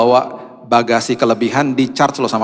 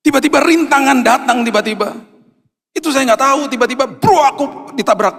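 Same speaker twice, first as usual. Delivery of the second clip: fervent preaching; 10 semitones higher than usual. Real, not acted. Preaching with rising fervor.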